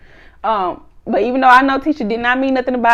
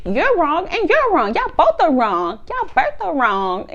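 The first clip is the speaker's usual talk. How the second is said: slow, lilting retold verdict; drawn-out mock scolding; 6 semitones higher, slower than usual. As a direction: stretch each word, mock-chiding both of them. Retold scolding of both sides.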